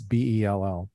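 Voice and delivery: letter by letter, B E L L; spelling it out. Shown as a demonstration. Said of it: "his first name is spelled".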